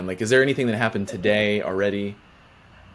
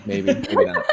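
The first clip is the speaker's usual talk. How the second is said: laughing. Real, not acted. Teasing before silly questions.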